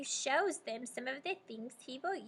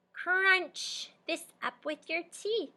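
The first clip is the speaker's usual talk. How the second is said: slow dentist voice; 6 semitones higher, 4 dB louder, slower than usual. Acted. Dentist character speaking.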